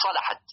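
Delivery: صلحت with an open "a" on the lam; the commoner fatha reading of صلحت. Shown as a demonstration. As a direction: say صلحت as salahat. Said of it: "والفتح أشهر أن تقول".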